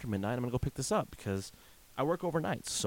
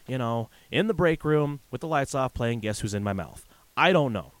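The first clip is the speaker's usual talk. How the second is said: usual speaking voice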